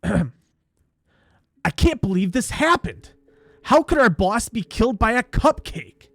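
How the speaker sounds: hammed-up worried amateur detective; worried factory worker. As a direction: act out alarm over a mystery. Looking worried and discussing the situation.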